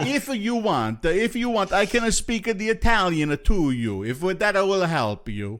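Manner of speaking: put-on a host hamming up an Italian accent for his guest. Joking Italian accent offered to the Italian guest. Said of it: "I can do an accent".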